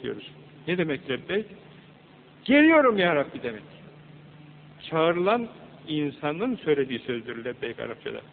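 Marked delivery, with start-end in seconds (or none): 2.4-3.4 yüksek ve tiz "Geliyorum ya Rabbi"; çağrıya coşkuyla karşılık ver; coşkulu seslenme. Acted. Allah'ın çağrısına cevap veriyor. (Rabbine cevap veren hacı gibi coşkulu, yüksek sesle)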